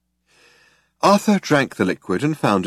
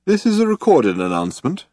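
lightly voiced old announcer, raised pitch; polite announcement with a touch of menace; courteous, menacing; 7 semitones higher than usual. A narrator's reading. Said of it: "The voice was old, courteous, almost charming, but was underscored with quite unmistakable menace".

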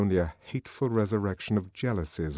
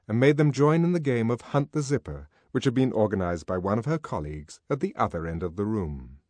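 ordinary delivery

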